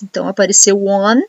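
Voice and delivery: ordinary talk, nothing marked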